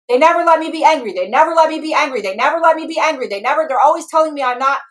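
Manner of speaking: loud, high, fast frustrated tirade; furious part exploding into a shriek; angry ranting. Acted. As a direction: rant without a breath, building to a scream. Acting enraged firefighter, told angry.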